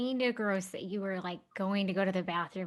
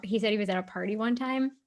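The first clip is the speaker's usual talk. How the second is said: ordinary talk, nothing marked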